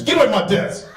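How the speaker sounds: high, sharp command from the desk sergeant; imitating a brusque sergeant telling him off; curt sergeant. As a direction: bark it like an irritated desk sergeant. Sergeant orders him back.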